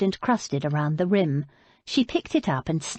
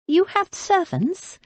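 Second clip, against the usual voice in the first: high, slow disbelieving question; incredulous; 12 semitones higher, slower than usual. Acted. Abby doubting the old lady's servants, incredulous. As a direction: let her doubt ring out.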